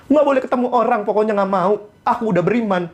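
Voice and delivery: high-pitched parody of stubborn piety; mock stubborn believer. Believer refusing to meet anyone, having surrendered to God, mock stubborn. Mocks believer who only waits indoors.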